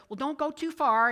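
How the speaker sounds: a dad calling out a warning; dad calling a warning across the farm. Retold or imitated.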